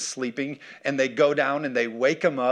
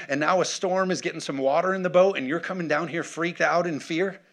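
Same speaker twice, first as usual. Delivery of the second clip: exasperated; 4 semitones higher than usual. Acted. Jesus to panicking disciples in the boat, exasperated. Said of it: "his response to them is like".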